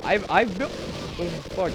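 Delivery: hiccup breaking the words; hiccupping mid-sentence. Real, not acted. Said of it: "I'm doing that drunk hiccup thing".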